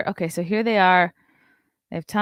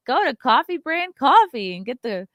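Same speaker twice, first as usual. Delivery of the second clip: high, mock sales-plug voice; hammed-up promo imitation; imitated coffee pitch; 7 semitones higher than usual. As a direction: push the product in a raised voice. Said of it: "he's pushing his coffee, like".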